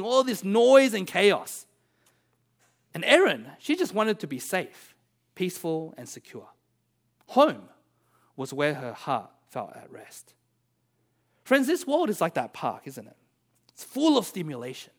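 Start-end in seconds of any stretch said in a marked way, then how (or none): none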